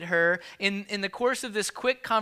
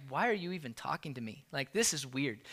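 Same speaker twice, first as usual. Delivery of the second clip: quiet mock rebuff; 7 semitones lower, 11 dB quieter, faster than usual. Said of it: "that person is like".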